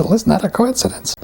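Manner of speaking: light Canadian rural farmer twang, pitched high; mock-innocent Canadian rural accent. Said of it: "at the way I said it".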